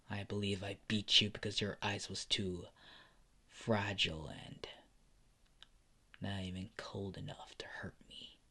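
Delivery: low, soft, fading to a whisper; quiet taunting. Acted. Endeavor taunting Sub-Zero about their last fight, quiet and low. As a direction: let the mockery sink into a hush. Villain taunts old rival.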